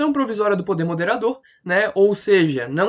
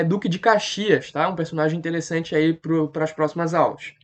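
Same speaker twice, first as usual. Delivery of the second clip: normal talking voice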